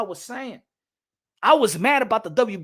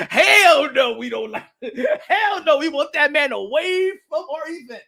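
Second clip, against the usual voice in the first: loud, high, laughing through a mock Top Rank refusal; cracking up while acting the promoter turning him away; laughing mock outrage; 5 semitones higher, 5 dB louder than usual. Laughing, mocking Top Rank reply.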